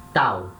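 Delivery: "tau" with a dry, crisp T; a short, clean T in "tau"; low, crisp "tau". Shown as a demonstration. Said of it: "um som mais seco".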